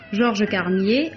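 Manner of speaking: ordinary talk, nothing marked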